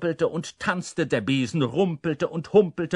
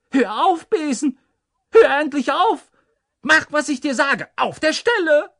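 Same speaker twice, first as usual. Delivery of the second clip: high, loud, desperate cries; pleading; 14 semitones higher, 11 dB louder than usual. A narrator's reading. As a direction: beg at the top of your voice for it to stop. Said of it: "flehte er".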